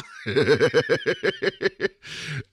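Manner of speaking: long, high rolling giggle; real laughter. Real, not acted. Host amused by his own impression, real giggling laugh. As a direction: crack up at your own joke.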